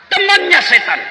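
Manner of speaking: TEMANNYA SETAN pushed high and loud. Shown as a demonstration.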